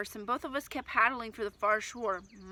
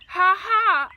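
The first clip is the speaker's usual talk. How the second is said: loud crazy cackle, much higher; wild laugh; 9 semitones higher, 14 dB louder than usual. Acted. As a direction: laugh wildly at the storm. Brother laughing again in storm.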